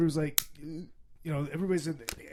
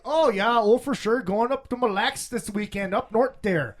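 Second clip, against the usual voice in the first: loud, high, hammed-up Minnesota accent; 7 semitones higher, 6 dB louder than usual. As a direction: ham up an up-north Minnesotan. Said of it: "you don't sound Fargo".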